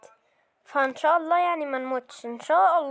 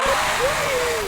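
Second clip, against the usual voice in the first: high, sharp gasp of fright; startled gasp; 9 semitones higher than usual. Real, not acted. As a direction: gasp in real shock. Startled by the game's enemy. (شهقة فزع عالية)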